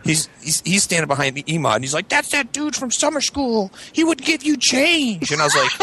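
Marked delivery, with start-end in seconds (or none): 2.1-5.3 slow, high-pitched mocking sidekick voice; drawn-out high tattletale whine; tattling toady. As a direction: imitate a little toady telling on someone.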